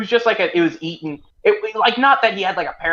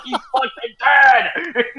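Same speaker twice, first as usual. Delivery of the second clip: drawn-out, high exclamation of shock; retold alarm; 9 semitones higher, 5 dB louder, much slower than usual. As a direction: stretch it out loud and high, mock-alarmed. Retelling his panicked reaction to news.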